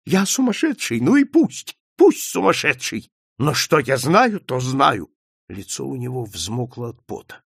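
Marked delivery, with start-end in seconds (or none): 0.0-5.1 high, frantic insistence; shouting. A narrator's reading. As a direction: cry out defiantly, heedless of sounding mad. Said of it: "закричал швед". (кричать с отчаянным упорством)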